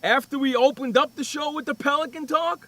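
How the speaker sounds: loud, high teasing call to a latecomer; mock loud call-out. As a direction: rib him loudly for showing up late. Teasing late cohost.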